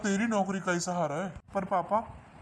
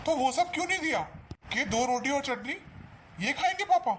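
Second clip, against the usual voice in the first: angry confrontation; 6 semitones higher than usual. Acted. Son confronts bhabhi angrily.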